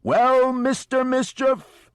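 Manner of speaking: long, high, very loud hollered call; operatic yell. Acted. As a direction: bellow it across the waves like an opera singer. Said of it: "he yelled again".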